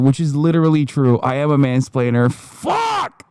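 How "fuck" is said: sudden high shouted curse; frustrated yell. Real, not acted. Streamer yelling after admitting he mansplains. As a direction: blurt it out in exasperation. Exasperated at being called out.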